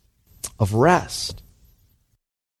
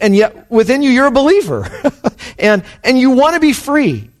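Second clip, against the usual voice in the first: high, impassioned preacher delivery, climbing in pitch; fervent preaching; 8 semitones higher than usual. Acted. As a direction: preach it with rising fervor up to a soaring wow. Preaches at rising force.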